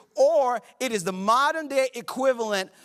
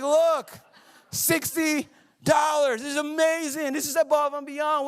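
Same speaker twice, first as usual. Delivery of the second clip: higher, slow on sixty dollars, thrilled; pumped; 6 semitones higher than usual. Retold or imitated. Husband showing his wife the love offering, excited. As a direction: show off the cash with glee. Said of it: "And I was so pumped".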